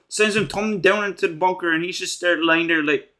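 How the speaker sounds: ordinary talk, nothing marked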